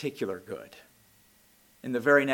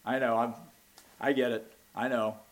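quieter, brushing him off; dismissive I know; 2 semitones lower, 4 dB quieter than usual. Retold or imitated. Martha brushing off Jesus's promise. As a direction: wave the words away.

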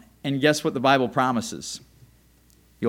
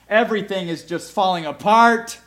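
ordinary talk

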